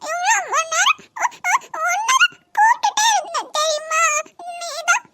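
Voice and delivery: put-on high, slow sobbing little girl; a hurt child sobbing out her complaint; crying child. Niece sobs to her mother that uncle ignored her.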